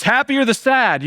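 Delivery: "Happy are the sad" proclaimed high and loud; voicing Jesus. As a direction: announce it like Jesus on the mountain, high and bold. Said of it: "and he goes".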